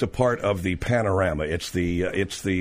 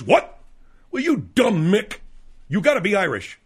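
tough bar guy; 7 semitones higher than usual. Retold or imitated. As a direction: sneer it like a big dude at the bar. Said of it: "he goes".

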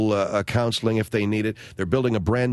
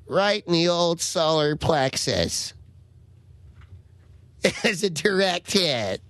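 put-on kid's proud drawl; 6 semitones higher, much slower than usual. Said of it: "And he goes".

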